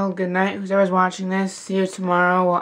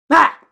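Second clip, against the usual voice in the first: jump-scare shout; 10 semitones higher, 7 dB louder than usual. Pretend. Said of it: "Did I scare you?".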